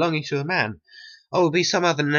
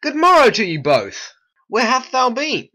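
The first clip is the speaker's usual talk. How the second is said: excited greeting; 9 semitones higher, 4 dB louder than usual. Acted. Greeting friends across street.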